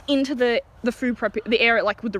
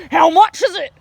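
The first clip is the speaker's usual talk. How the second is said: loud, raised imitation of an irate customer; hammed-up rude shout; mock angry demand; 5 semitones higher, 6 dB louder than usual. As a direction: blurt it like a furious customer.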